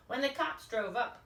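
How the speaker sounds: ordinary delivery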